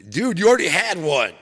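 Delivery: put-on high loud kid protest; kid's squeal. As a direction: squeal it like an outraged little brother. Kids quarreling over vitamins.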